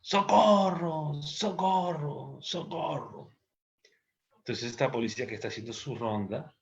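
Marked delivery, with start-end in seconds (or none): 0.0-3.3 long drawn-out, high call for help, fading away; slow, high-pitched mock distress call; acted cry for help. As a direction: stretch the word out like a far-off cry. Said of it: "están gritando".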